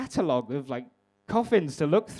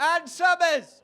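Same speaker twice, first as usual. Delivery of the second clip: high-pitched mock call from across the street; imitated shout-out; 12 semitones higher, much slower than usual. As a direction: sing it out like an old guy hollering. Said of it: "I had an old guy shout out".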